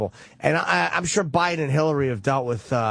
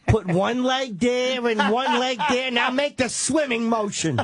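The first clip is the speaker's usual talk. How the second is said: coaching parent; 6 semitones higher, slower than usual. Acted. Coaching a child to swim.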